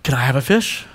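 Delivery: put-on low, hushed little-boy ask; a soft, hopeful kid asking for a fish; imitating his son. Pastor voicing his son begging for a fish, hushed. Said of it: "he's like".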